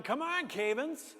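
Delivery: high, drawn-out classmates' call; mock yell. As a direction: imitate impatient kids calling down the line. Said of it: "So they're yelling".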